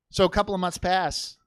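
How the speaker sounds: normal talking voice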